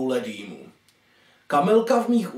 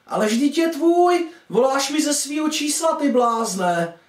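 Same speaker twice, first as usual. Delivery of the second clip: much higher mum teasing her son; amused mother; 9 semitones higher than usual. Acted. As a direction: tease him in a high mother's voice. Mother teases her confused son. (vysoký hlas mámy, která se diví)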